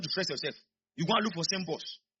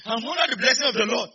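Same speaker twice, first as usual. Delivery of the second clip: loud, raised-pitch proclamation; full-voiced church declaration; forceful declaration; 5 semitones higher, 7 dB louder than usual. Real, not acted. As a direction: declare it louder to rouse the congregation. Repeats declaration forcefully to congregation.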